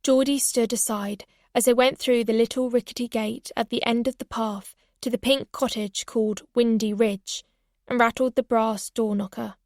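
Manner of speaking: ordinary delivery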